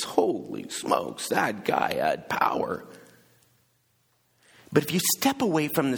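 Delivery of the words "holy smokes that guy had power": low, slow awestruck whisper; awed hush. Retold or imitated. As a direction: breathe it out in wonder. Awed reaction to the miracle.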